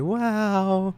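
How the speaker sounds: high loud wow; awed wow. Pretend. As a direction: gasp out a stunned wow. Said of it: "and you're like".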